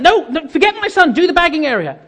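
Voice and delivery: high, insistent ordering; acted exasperation. Customer orders assistant to sort bagging area, exasperated.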